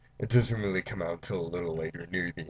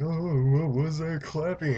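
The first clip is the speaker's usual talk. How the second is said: high-pitched mock panic; freaking-out mom; 7 semitones higher, 3 dB louder than usual. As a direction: imitate a mom in a fluster. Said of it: "The mom's freaking out".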